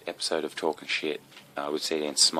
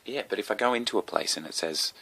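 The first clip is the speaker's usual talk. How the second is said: ordinary talk, nothing marked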